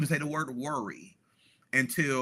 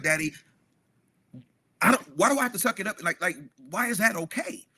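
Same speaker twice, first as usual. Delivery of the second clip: voicing his hurt son, pitched much higher and rushed; upset son pleading; 6 semitones higher, faster than usual. Father voicing his son asking why he must take it, high and rushed. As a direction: let the boy's disbelief spill out fast and high.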